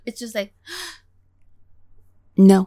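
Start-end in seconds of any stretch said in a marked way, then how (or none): 0.6-2.7 sharp gasp, then loud no; hammed-up loud denial after a gasp; imitated gasped refusal. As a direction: gasp and blurt a mock refusal. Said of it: "I will sound just like that".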